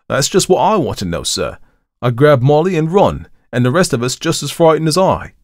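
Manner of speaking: baffled Cockney, high and loud; Thomas. A narrator's reading. Thomas admits he ran away, in agitated Cockney. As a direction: puzzle it out loudly in Cockney. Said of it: "had not only modified Thomas's Cockney dialect".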